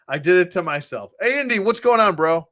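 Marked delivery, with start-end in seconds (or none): none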